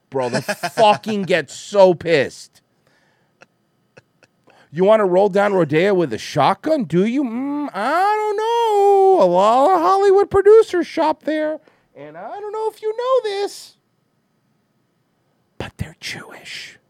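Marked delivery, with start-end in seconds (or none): 4.7-13.8 sly mock voice. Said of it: "mock trolling the band". 15.6-16.8 conspiratorial whisper; hushed mock-secret whisper. Conspiratorial punchline.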